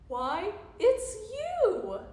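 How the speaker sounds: high, slow, loud storybook reveal; sing-song it out, drawn long; hammed-up merry answer; amused reply. A narrator's reading. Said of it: "they laughed and said".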